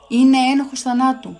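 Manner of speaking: a whole council condemning him at once; loud verdict. Acted. The council answers Caiaphas, loud and condemning. Council pronounces death sentence.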